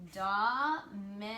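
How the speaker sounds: "Dominic" very slow with each part held; pauses between dom, i, nic in "Dominic"; split into syllables. Shown as a demonstration. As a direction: isolate the three pieces of "Dominic". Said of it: "He has three".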